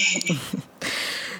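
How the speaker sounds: a short, breathy, shy laugh; soft laugh. Real, not acted. Guest laughing softly after thanking the host for having her. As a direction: let a little laugh slip out.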